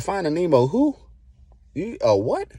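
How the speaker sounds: high, loud confused "a what?"; baffled disbelief. Retold or imitated. Patient hearing a strange diagnosis from the doctor, high baffled yelp. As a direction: throw it back at the doctor in disbelief.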